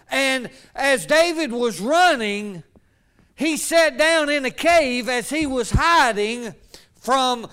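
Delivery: loud, driving preacher cadence; building pulpit preaching voice. Acted. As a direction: preach it out to the congregation. Preaching at full force.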